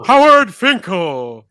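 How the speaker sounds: big, slow ring announcer call; Howard Finkel. Acted. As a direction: belt out the name, stretched long. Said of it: "My name is Howard Finkel".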